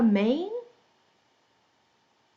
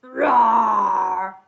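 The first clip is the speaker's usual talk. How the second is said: big rough lion's roar; 5 dB louder than usual. Pretend. Teacher shows children the story cue, big lion roar. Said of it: "when you hear lion".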